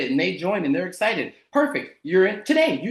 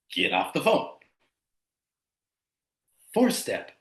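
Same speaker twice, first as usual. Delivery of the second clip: usual speaking voice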